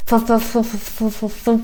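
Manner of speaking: stuttered run of f sounds; tripping over braces. Real, not acted. Teacher with new braces attempting a word, stuttering. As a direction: stumble repeatedly on the first sound. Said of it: "practicing to speak, uh, with braces".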